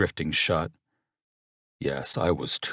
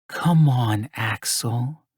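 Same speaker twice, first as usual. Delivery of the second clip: coaxing woman; 4 semitones higher, much slower than usual. Acted. Mirabella coaxing him.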